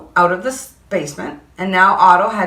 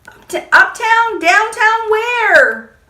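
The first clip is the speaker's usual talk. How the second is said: high, louder fretting; frantic child; 13 semitones higher, 3 dB louder than usual. Acted. Boy frets to firemen, high frantic voice. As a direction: rattle off places in a panic. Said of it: "I asked".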